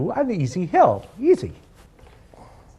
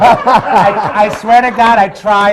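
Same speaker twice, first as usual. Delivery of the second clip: high, loud laughing words; 6 semitones higher, 9 dB louder than usual. Real, not acted. Laughing while trying to talk.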